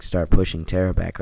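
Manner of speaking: normal talking voice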